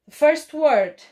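"First word" called out high and loud; loud announcing. Shown as a demonstration. Teacher calls out the first dictation word loudly. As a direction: call the class to attention. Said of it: "teacher calls out the task".

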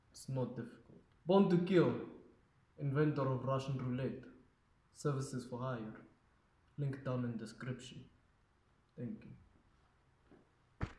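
low, soft Russian tough guy; Russian accent. Acted. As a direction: mutter it like a cold Moscow hitman. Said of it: "All the way from Moscow, Russia".

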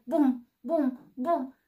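loud, heavy booming steps. A narrator's reading. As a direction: thud it out as the bear nears. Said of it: "din ce în ce mai tare".

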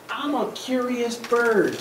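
slow, gently reasonable little duck; innocent duck. Acted. Duck explains himself to the pigeon, innocent. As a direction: explain it mildly, taking your time.

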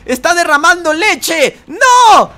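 loud high shout at the monster; panicked gamer shout; excited yell. Real, not acted. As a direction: yell it out in alarm.

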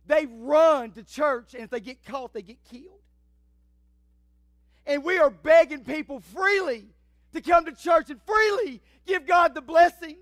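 loud, driving sermon delivery; impassioned preaching. Real, not acted. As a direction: preach it hard, punching the key words. Preaches at full force.

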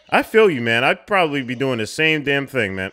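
loud, high burst of sympathy; fired-up agreement. Real, not acted. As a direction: jump in loudly, totally siding with him. Excitedly siding with frustrated film character.

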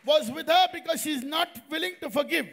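loud, forceful declaration; emphatic preaching. Real, not acted. Preacher explaining the illness to congregation, emphatic. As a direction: drive the lesson home loudly. Preacher drives the lesson home.

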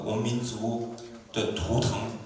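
ordinary talk, nothing marked

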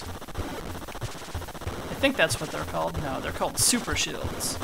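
slow unsure whisper; whispered aside. Real, not acted. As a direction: whisper it to yourself. Aside to himself.

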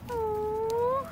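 usual speaking voice

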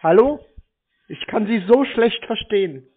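ordinary talk, nothing marked

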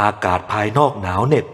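plain reading voice

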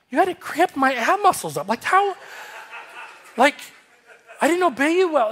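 high-pitched baffled complaint; incredulous protest. Retold or imitated. Retold protest to God.

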